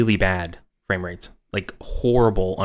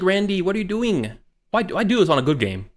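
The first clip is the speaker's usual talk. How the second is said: exasperated; 9 semitones higher than usual. Pretend. Exasperated gamer voiced, slowed.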